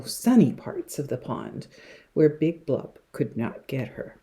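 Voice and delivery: plain reading voice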